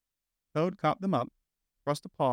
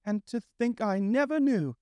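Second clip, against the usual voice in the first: high, slow, entranced murmur; lost in a daydream, drifting; dreamy monotone; 10 semitones higher, 3 dB louder than usual. A narrator's reading. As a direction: Toad mooning over the motor car, dreamy and slow. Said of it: "in a dreamy monotone".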